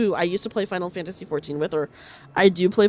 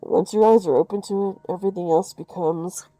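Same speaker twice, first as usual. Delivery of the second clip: muffled voice; 5 semitones lower than usual. Acted. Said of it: "my muffled voice for Rim".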